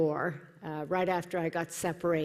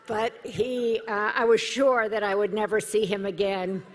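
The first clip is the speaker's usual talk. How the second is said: usual speaking voice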